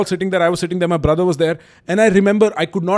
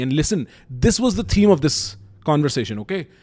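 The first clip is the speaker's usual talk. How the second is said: normal talking voice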